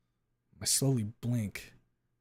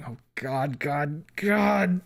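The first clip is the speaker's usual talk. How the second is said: rising, higher, louder panicked cry; 4 semitones higher, 6 dB louder, much slower than usual. Acted. Narrator seeing the shooting again, panicked cry. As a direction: cry it out as the vision hits.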